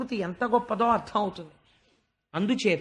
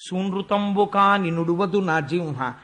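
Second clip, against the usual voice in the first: poem recitation; 4 dB louder than usual. Acted. Storyteller opening the Vamana episode, chanting the verse. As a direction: intone the padyam like a temple reciter.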